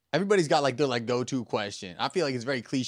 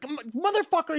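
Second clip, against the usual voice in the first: offended Frenchman. Acted. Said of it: "He's like".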